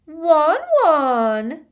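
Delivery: high, loud gushing squeal. A narrator's reading. Lavender hurtling towards Ron, a loud delighted squeal. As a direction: squeal the pet name across the room. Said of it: "there was a loud squeal of".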